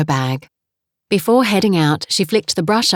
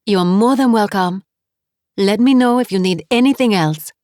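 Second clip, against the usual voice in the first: put-on French accent; 4 semitones higher than usual. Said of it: "her accent was heavy".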